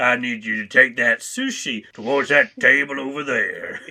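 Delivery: a cowboy giving orders; cowboy accent. Pretend. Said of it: "sounds more like a cowboy".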